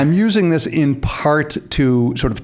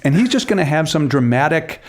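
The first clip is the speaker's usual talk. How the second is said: ordinary delivery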